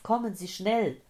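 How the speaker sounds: loud, high-pitched urgent demand; urgent, agitated cry. A narrator's reading. Old man summoning the emergency doctor, loud and urgent. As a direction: press them loudly to hurry. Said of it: "keuchte der Mann".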